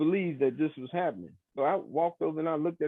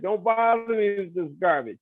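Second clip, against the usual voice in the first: louder, higher mimicked shout, voice changed; retold yell; 6 semitones higher, 6 dB louder than usual. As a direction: shout him off like a grumpy old lawyer. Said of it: "he was yelling, he was screaming".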